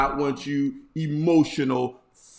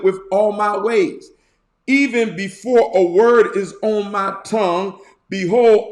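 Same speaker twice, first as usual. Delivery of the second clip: lifted, louder scripture reading; 3 semitones higher, 4 dB louder than usual. Acted. Preacher reading the psalm aloud, lifted and louder. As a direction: proclaim the psalm to the congregation.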